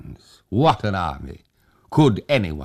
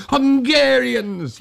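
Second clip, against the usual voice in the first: put-on high, loud old man's cry of relief; a delighted old man throwing up his hands; old Tag, relieved; 5 dB louder, slower than usual. A narrator's reading. Old Tag calls to the hussars, high and relieved.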